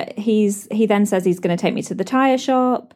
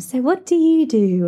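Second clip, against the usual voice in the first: a light, breezy networking voice, pitched a bit higher; mock serene networker; 6 semitones higher than usual. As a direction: play a calm, polished guest making small talk. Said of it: "everyone's, like, serene and".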